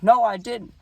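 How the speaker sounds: high, insistent denial; mock innocent denial. Young catcher sticking to his lie, insistent denial.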